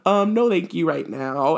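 high, slow, mock-polite turn-down; hammed-up hesitant "no thanks"; awkward polite refusal. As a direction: imitate yourself declining awkwardly, drawn out.

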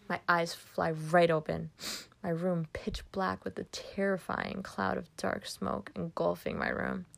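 usual speaking voice

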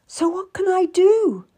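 high, loud, desperate question. A narrator's reading. Wife refused help for her husband, desperate. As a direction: cry out for a way forward. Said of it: "I was desperate".

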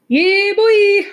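loud, high hype shout. Real, not acted. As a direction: hype it up loudly. Hyping up the quote.

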